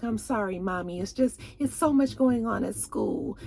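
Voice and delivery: soft, acted apology to mom; apologetic daughter. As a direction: play a daughter quietly excusing herself. Daughter apologizing to her mother, acted.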